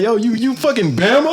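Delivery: high, mock East Coast taunt. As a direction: toss the jab like a New York guy. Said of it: "people on the East Coast like".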